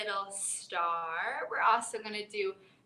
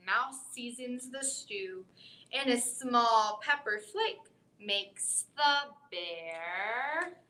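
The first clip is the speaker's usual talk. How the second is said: ordinary talk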